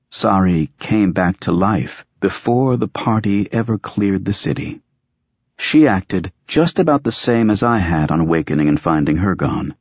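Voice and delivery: ordinary delivery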